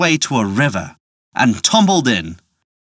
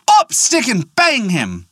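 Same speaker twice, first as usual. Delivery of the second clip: "Up, stick" cried out much higher, with "and bang him" slower after it; 8 semitones higher, slower than usual. A narrator's reading. Jack cries the magic command at the innkeeper, much higher. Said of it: "he cried".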